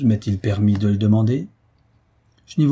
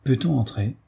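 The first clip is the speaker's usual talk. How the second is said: plain reading voice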